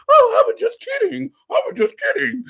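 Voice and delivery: high, loud mimicked excuse; mock backpedalling. Imitating men who backpedal, high mocking voice. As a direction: squeal the excuse in mockery.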